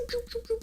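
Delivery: high, soft "choo" for a cat bouncing; bouncing sound effect. Retold or imitated. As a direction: squeak it like a sprite hopping. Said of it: "she just like, choo".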